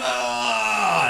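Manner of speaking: high pained cry, held back; underwater scream. Retold or imitated. Surfer retelling the reef cut, high pained cry. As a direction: cry out as the reef cuts you. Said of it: "I'm screaming underwater".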